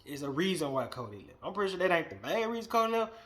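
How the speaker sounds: ordinary talk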